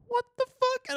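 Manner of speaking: mock disgusted guard; squeaky shocked guard voice. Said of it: "security guard was like".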